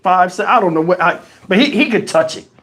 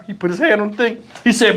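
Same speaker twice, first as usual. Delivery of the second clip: usual speaking voice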